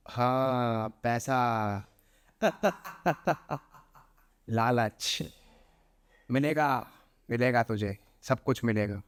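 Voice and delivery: low, eerie spirit voice with a sly laugh; lightly voiced witch luring with money. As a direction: tempt him with greed, low and otherworldly. Spirit tempts him with money.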